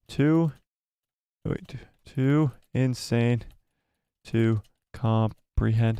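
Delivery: slow, halting words with long gaps; slow typing dictation. Real, not acted. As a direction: say each word as you type it. Typing a poll option word by word.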